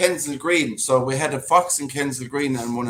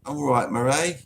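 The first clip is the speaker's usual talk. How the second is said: mock English accent; 3 semitones higher, 3 dB louder than usual. Co-host teasing Marie about her posh English accent, mock English accent. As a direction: tease with a hammed-up English accent. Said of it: "She's an English accent, very posh".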